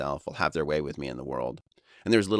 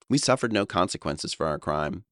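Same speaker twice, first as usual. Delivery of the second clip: usual speaking voice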